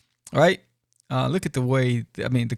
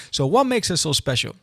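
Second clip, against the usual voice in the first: ordinary talk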